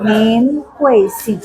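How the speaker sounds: very slow, each syllable separate; polite question. Shown as a demonstration. Teacher modelling a respectful name question, very slow. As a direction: ask slowly with respect.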